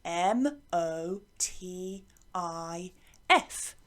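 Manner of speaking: slow letter-by-letter spelling. Shown as a demonstration. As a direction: spell each letter clearly and slowly. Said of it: "what we call a motif".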